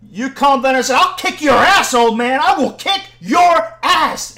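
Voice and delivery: high, loud shouted threat; angry threat. Retold or imitated. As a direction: imitate a man yelling threats. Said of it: "and he's like".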